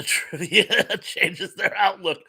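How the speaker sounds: amused laugh breaking into the words; laughing while reading. Real, not acted. Reading Indy's joke to the chat, laughing.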